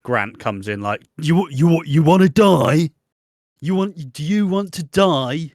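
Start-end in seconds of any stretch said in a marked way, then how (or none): 1.2-5.5 loud, drawn-out incredulous demand; Ross Kemp impression. Grant confronting Nigel about dying, Ross Kemp impression. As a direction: push each word out slow, raised and forceful. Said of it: "picture perfect Ross Kemp impression".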